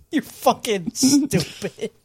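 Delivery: cry-laughing, slowed right down. Real, not acted. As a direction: squeeze it out through helpless laughter. Laughing hard at a joke.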